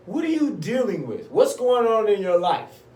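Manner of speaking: high, insistent questioning; probing hospital staffer. Retold or imitated. Acting staff probing his life.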